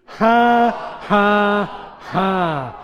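three big, separate laugh syllables; deliberate loud "ha". Shown as a demonstration. Preacher leading congregation to try laughing, loud deliberate ha-ha-ha. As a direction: call out each "ha" high and loud, stepping down. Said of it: "Why don't you try that?".

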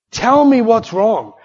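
high, loud, slow demand; insistent demand. Retold or imitated. Wife demanding an answer from her secretive husband, insistent. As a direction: press for an answer, stretching it out loudly.

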